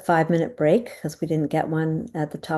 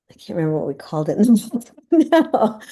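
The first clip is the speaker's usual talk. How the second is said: quick, higher, laughing through the words; laughing at herself; 6 semitones higher, 5 dB louder, faster than usual. Real, not acted. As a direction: laugh off the memory slip. Forgets chapter name, laughs.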